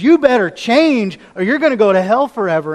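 loud, harsh warning of hellfire; stern warning. Retold or imitated. Jesus warning the Pharisees, loud and stern. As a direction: thunder the threat of judgment. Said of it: "comes across with the same sort of spirit".

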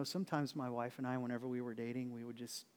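usual speaking voice

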